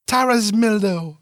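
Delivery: high, sustained silly cry, gently falling; playful nonsense call. A narrator's reading. Meg nuzzling and playing along, high nonsense call. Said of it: "goes she".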